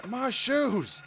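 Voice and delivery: loud, high, slow command to the dogs; real exasperated yell. Real, not acted. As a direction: yell each word out, fed up. Yelling at dogs mid-story.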